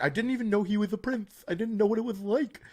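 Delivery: high-pitched mocking impression; mock Meghan. Mocking her claim she didn't know, high put-on innocent voice. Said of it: "she claims she didn't know".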